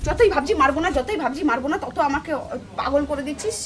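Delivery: bitter muttering she can barely hold in; seething under breath. Real, not acted. Wife fuming after the quarrel.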